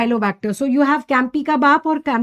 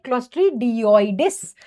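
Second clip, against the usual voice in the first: Clostridioides said slowly, syllable by syllable; slow, careful name; much slower than usual. Shown as a demonstration. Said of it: "Like I said".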